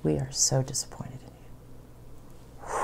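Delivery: slow, soft, unvoiced disappointment; disappointed whisper. Parents telling her they are disappointed, slow whisper. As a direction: let the words fall heavy and hushed.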